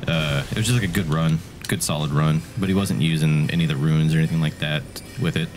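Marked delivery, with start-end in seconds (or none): none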